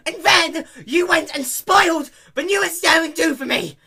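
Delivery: high, loud accusing shout; furious complaint; angry accusation. Acted. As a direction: blame them at full volume. Dash scolding the pegasi.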